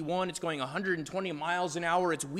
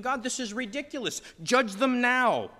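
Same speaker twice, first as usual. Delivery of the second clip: pleading for judgment in frustration; exasperated complaint. Retold or imitated. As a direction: believer complaining to God about mercy, exasperated. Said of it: "We're thinking".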